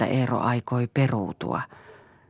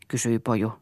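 ordinary delivery, nothing marked

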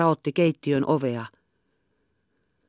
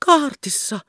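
high, alarmed echo of a word; a girl overhears and is shocked; startled question; 10 semitones higher than usual. A narrator's reading.

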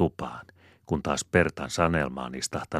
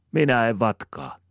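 insisting; 7 semitones higher, 5 dB louder than usual. A narrator's reading. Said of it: "inttää pieni ja musta Sanelma".

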